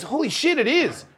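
high-pitched excited agreement; imitated amazed girlfriend.

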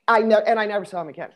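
ordinary delivery

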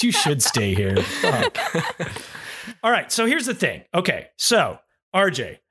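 ordinary talk, nothing marked

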